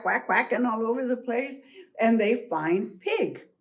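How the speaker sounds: plain reading voice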